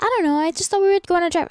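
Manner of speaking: high, quick friend voice, pitched well up; imitating clueless friend. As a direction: lightly play the clueless friend. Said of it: "She was like".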